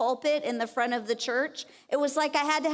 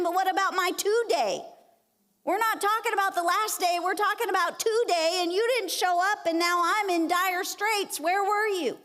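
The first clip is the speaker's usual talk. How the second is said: higher, fast, heated pleading; angry complaint; 5 semitones higher, faster than usual. Retold or imitated. Martha demanding answers from Jesus, fast and heated. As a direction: rush the grievance out, pushing hard. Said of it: "her disappointment and her anger".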